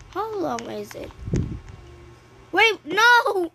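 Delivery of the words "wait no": high, loud panicked no; real startled shout; alarmed cry. Real, not acted. Something goes wrong.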